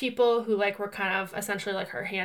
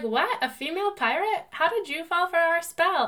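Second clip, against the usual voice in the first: surprised sirens; 7 semitones higher than usual. Retold or imitated. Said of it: "They're like".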